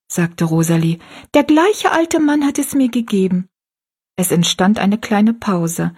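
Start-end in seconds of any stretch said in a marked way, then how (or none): 1.3-3.5 put-on high-pitched, keen little girl; much higher, girlish voice; girl's voice. A narrator's reading. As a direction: share it as a young girl. Narrator voices young Rosalie.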